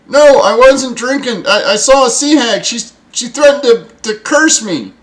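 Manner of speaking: high, loud, panicky lookout; frightened protest. Acted. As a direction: protest shrilly, scared and stammering. Terrified lookout protests to bosun.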